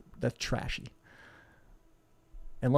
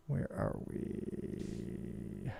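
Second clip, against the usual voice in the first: whispered to self; 14 semitones lower, 10 dB quieter, much slower than usual. Real, not acted. Searching his screen.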